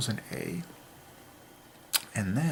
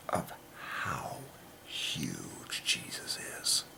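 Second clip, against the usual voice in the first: slow, urgent whispered plea; pleading whisper to the viewer; intense whisper; 10 semitones lower, 7 dB quieter, much slower than usual. Real, not acted. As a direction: lean in and whisper it like it matters most.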